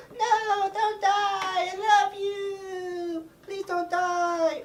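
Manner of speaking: very high, drawn-out fake sobbing; mock wailing. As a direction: wail hammily over a dying lover. Belle over the dying Beast.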